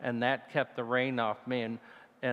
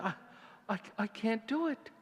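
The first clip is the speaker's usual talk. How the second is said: retold fear; 10 semitones higher, 4 dB quieter, faster than usual.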